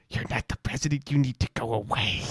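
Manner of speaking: mushy, spit-filled child impression; mock a small child with a mouthful of spit; slurred, slobbery toddler voice; spitty little kid. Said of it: "he says it like that with all the spit in his mouth".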